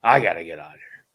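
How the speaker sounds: imitating the journeyman blurting it out fast; fast frustrated outburst. Journeyman to the storyteller, fast fed-up outburst. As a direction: rush it out, fed up. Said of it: "He's like".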